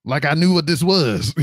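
normal talking voice

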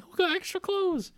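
very high-pitched squawked question; mock incredulous. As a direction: fire it back in disbelief, voice leaping high. Acted retort in retold quarrel.

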